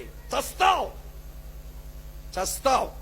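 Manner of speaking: a sudden harsh warning cry; a sharp, prophetic warning. Acted. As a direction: fling the threat out hard. Preacher warns of ruin.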